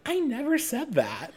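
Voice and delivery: high-pitched mock royal quote; Marie Antoinette. Quoting the queen's denial, high put-on voice. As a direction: pipe it up high, close to laughing. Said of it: "She goes".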